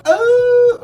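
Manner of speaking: high, loud startled "Oh!"; surprised gasp. Retold or imitated. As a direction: yelp "Oh!" as if caught off guard. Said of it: "I'm like".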